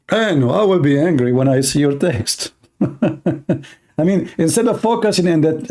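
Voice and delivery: normal talking voice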